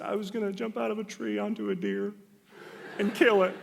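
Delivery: high-pitched, hammed-up bawling kid; imitated tearful kid confessing in a high voice; mock crying. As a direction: wail it like a sobbing child, for laughs. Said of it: "I was crying profusely".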